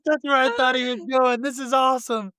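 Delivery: excited, high-pitched exclamation through laughter; delighted reaction. Retold or imitated. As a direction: burst out a thrilled yes, laughing as you go.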